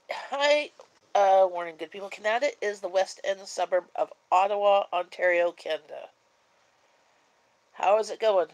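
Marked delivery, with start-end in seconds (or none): none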